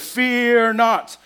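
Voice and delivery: loud, booming mock angel pronouncement; deep angel voice. Kid angel announcing to shepherds, mock booming. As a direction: proclaim it like a child playing the angel. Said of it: "deepest angel voice".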